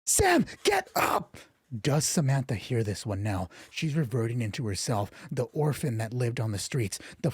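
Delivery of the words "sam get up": slow, very high pleading cry; desperate wail. Acted. Desperate call to fallen Samantha.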